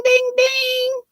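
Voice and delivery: sing-song ding-ding like a chime; playful high bell imitation; high sung ding.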